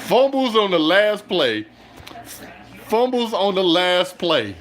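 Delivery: high, loud, slow exclamation; loud disbelief. Real, not acted. Fan repeating the last-play blunder, loudly astonished. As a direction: call it out slowly, astonished.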